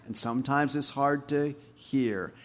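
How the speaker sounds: usual speaking voice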